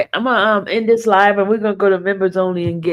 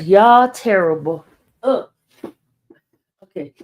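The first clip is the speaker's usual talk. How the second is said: normal talking voice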